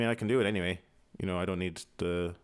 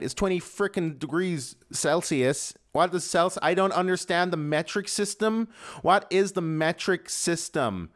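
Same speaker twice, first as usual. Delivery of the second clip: a hammed-up clueless American asking about Celsius; American accent; 8 semitones higher, 5 dB louder than usual. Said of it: "I can do accents".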